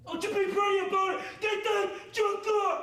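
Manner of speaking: barked orders at full volume; drill sergeant shouting. Real, not acted. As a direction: yell it like a drill instructor. Drill instructor yelling.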